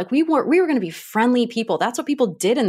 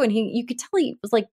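normal talking voice

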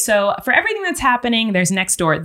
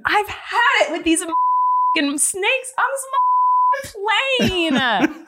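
high, mock-furious read with a bleeped swear; over-the-top fed-up rant; hammed-up movie line; 10 semitones higher, slower than usual. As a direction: crank it up and ham it. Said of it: "do it again".

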